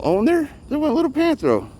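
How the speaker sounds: sudden high excited squeal. Real, not acted. Spotting Panthro dash away, excited high squeal. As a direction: pitch way up as the cat darts off. Spots the cat running off.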